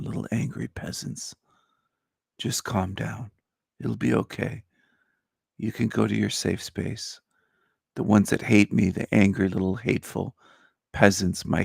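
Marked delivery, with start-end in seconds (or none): 0.0-7.2 soft half-whispered hush; mock soothing whisper. Calming his haters, a soft mocking whisper. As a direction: coo at them like upset infants. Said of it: "I'll tell them".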